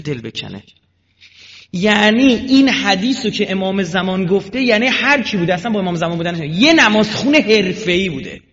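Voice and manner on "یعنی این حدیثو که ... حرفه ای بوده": loud, high, slowed preaching; fiery preaching. Acted. Preacher to the congregation about prayer, loud fiery preaching. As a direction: hammer it home from the pulpit.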